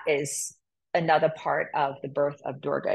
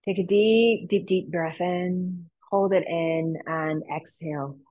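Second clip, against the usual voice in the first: slow, calm teacher cues; guided yoga instruction. Acted. Teacher guides the class through a mudra, calm and slow. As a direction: guide the class gently through each hand movement.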